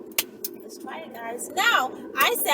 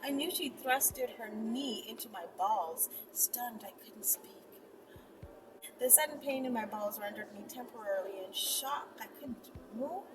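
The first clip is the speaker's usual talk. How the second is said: hushed, intimate storytelling voice; soft intimate narration; soft story reading; 12 dB quieter than usual. A narrator's reading. Reads erotic story softly with music.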